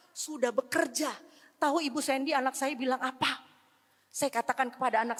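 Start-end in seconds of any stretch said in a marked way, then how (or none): none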